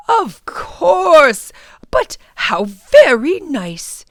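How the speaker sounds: put-on warmly pleased old gentleman; mock delighted exclamation; delighted professor.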